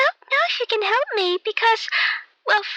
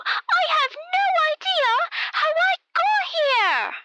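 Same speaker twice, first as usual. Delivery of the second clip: higher, exasperated outburst; raised voice; 6 semitones higher than usual. Acted. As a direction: let it rise as patience runs out. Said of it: "whoa, keep your voice down".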